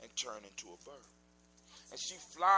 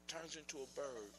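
usual speaking voice

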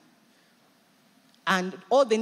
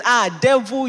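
loud rebuke; 9 dB louder than usual. Acted. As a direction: call out the devil, loud. Rebuking the devil aloud.

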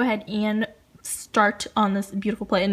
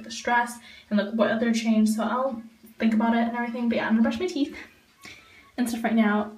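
ordinary delivery

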